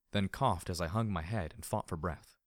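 plain reading voice

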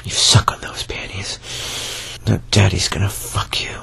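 breathy close-mic whisper; low intimate whisper throughout. Acted. Whispered roleplay.

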